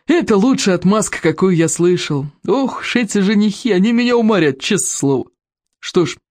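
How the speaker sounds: high, lilting, tickled grumbling; jovial chief, lightly exasperated; amused berserker chief. Acted. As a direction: marvel theatrically at these suitors.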